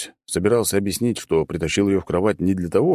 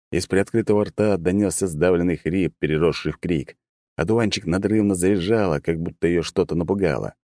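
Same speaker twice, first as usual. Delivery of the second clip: ordinary delivery, nothing marked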